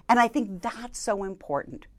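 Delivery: normal talking voice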